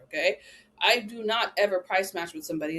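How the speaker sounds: ordinary talk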